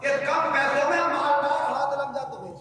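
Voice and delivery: impassioned sermon. Acted. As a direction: chant the contrast to the crowd. Sermon cadence, raised pitch. (तरन्नुम में was)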